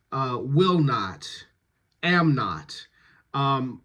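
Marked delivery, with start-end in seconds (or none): 0.4-1.3 slow "will not", "will" emphasised; stress on WILL. Shown as a demonstration. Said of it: "stress is always on the non-negative". 2.0-2.8 "am" emphasised, "not" falling away; stress on AM. Shown as a demonstration. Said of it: "stress is always on the non-negative".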